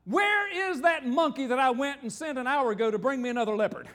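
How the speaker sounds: loud, high bluffing call; cries out. Acted. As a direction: shout it so the leopard behind hears. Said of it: "he cries out".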